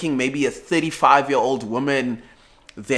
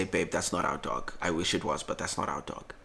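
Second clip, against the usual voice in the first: gentle letdown; 7 semitones lower, 12 dB quieter than usual. Retold or imitated. Gently correcting his girlfriend.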